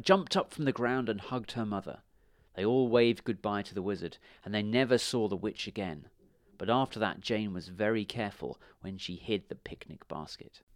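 ordinary delivery, nothing marked

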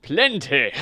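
friendly horse. Acted. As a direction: offer it freely as a kind horse. Friendly horse offers food.

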